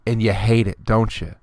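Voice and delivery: normal talking voice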